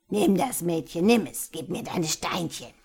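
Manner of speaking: put-on loud, greedy old witch voice; greedy screech. A narrator's reading. Witch trading the girl for treasure, greedy screech. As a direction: demand it like a grasping hag. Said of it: "kreischte sie auf vor Gier".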